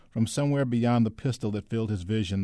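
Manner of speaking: plain reading voice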